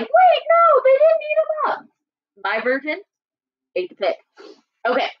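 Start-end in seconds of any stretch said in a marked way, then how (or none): 0.0-1.9 high-pitched mock shock; mock protest. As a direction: imitate upset listeners in a loud, high voice. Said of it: "They're like".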